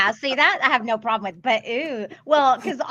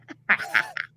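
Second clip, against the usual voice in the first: high witchy cackle; witch cackle. Pretend. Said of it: "your witch cackle?".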